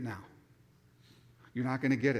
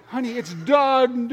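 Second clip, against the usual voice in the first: slow, louder backtrack; 6 semitones higher, 8 dB louder, slower than usual. Retold or imitated. Husband to wife, hasty backtrack, slowed and louder. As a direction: slowly try to take it back. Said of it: "trying to backtrack".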